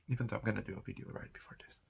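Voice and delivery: fast secretive whisper; hushed quick aside. Real, not acted.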